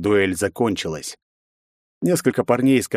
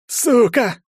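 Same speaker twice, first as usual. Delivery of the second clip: loud, much higher pained cry; groaning curse; 14 semitones higher, 5 dB louder than usual. A narrator's reading. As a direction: curse while winded on the asphalt. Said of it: "простонал тот". (со стоном)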